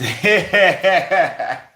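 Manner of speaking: big high burst of laughter; loud laugh. Real, not acted. Storyteller cracking up loudly at his own punchline.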